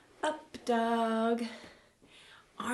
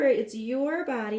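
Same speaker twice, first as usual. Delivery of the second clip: ordinary talk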